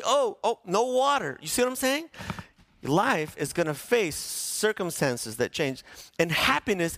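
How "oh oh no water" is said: higher mock dismay; mock alarm. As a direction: Israelites panicking again. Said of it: "They get through the Red Sea".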